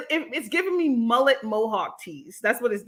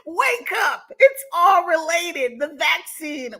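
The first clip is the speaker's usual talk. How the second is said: higher, laughing parody of his warning; a hint of the conspiracy preacher, slow on related; mocking the ranter; 6 semitones higher, 4 dB louder, slower than usual. Streamer parodies the ranting man to chat, higher and laughing. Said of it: "Did y'all hear him?".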